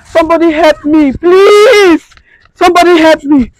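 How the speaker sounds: high, loud desperate scream; screaming for help. Acted. Wife calling out for help over husband's body, desperate scream. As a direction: scream for anyone to come.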